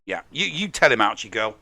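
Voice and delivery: ordinary talk, nothing marked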